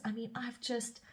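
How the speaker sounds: ordinary talk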